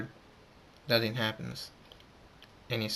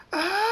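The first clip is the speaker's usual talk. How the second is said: long, very high scream; acted scream; 5 dB louder than usual. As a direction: hold out a high-pitched "aah". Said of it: "Thunderstorm screams".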